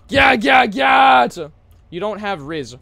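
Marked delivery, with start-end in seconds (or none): 0.1-1.3 high, loud repeated holler; hyped shout. Real, not acted. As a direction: yell it in bursts, stretching the last. Shouts out suddenly on stream.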